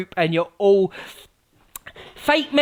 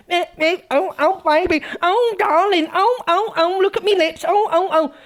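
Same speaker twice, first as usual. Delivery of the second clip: high, simpering mock of a vain poser; mocking CLA pouting; 7 semitones higher than usual. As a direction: coo it high like someone admiring their own lips. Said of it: "pulling fucking C L A expressions".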